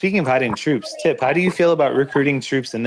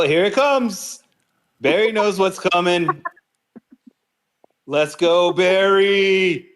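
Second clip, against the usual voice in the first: higher, slow build to a cheer; hyped commentary; 7 semitones higher, slower than usual. Acted. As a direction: call the play like a hype caster. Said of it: "Barry said I have to commentate this".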